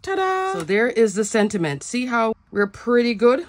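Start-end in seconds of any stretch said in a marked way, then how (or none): 0.0-0.5 high, drawn-out sing-song ta-da; playful reveal. Real, not acted. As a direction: present it with a high, held flourish. Reveals the finished stamped sentiment.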